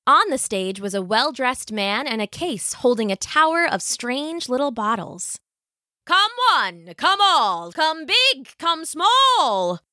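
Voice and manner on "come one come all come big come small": high showman's barker call; crying out. A narrator's reading. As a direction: call the crowd in with drawn-out, lilting cries. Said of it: "cried the man".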